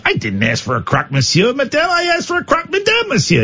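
mock French accent, pitch climbing on the mix-up, fast; playing the confused diner; a flustered diner in a hammed-up French accent; comedy sketch line. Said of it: "a lovely Abbott and Costello sketch".

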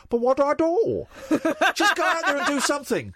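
high, giggling camp [name]; high silly [name] scat; giggly [name]. Retold or imitated. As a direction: camp nonsense scat, laughing. [name] impression laughing.

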